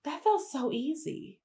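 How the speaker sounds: soft, high musing; uneasy relief. Retold or imitated. Traveler settles into her plane seat, soft uneasy relief. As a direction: think aloud softly, high-pitched. Acted relief before realizing mistake.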